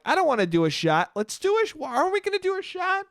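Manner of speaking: whiny-friend impression climbing to falsetto; mock reluctant friend. Retelling a friend balking at a shot, high mock protest. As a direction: voice shoots up high in imitation.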